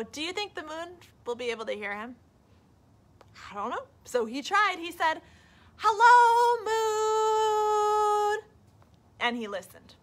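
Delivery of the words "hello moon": high, loud call up to the sky; a loud hopeful call into the night. Acted. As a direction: call out to the moon far above. Bear calling up to moon.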